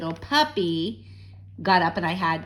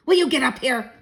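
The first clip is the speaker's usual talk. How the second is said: hissed; 6 semitones higher, 7 dB louder than usual. A narrator's reading. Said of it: "he hissed".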